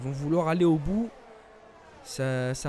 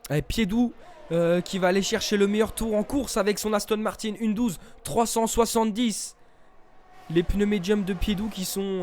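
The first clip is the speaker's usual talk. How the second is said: motorsport commentator; 5 semitones higher, 4 dB louder than usual. Real, not acted. Live race play-by-play.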